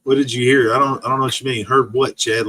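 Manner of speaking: ordinary talk, nothing marked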